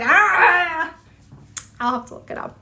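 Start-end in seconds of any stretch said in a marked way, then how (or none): 0.0-0.9 short, higher, louder mock scream; imagine a panicked man yelling "ah"; playful imitation of a startled yell; mock Irishman's yell. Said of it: "probably this Irishman going".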